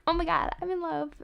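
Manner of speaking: acted-out lovestruck exclamation; lovestruck gushing. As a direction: gush as if suddenly smitten. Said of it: "believe, like".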